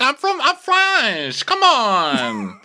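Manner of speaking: very high, drawn-out protest; mock exasperated wail. French girl answered in English, high mock wail. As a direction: whine it out in hammed-up exasperation.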